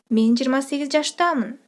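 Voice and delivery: ordinary talk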